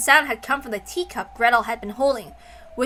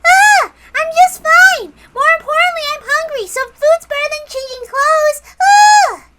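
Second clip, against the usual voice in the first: put-on high, bright-eyed child insisting she's fine; squeaky little girl, loud and eager; 11 dB louder than usual. Little Maria tells her mama she's hungry, high childish shout.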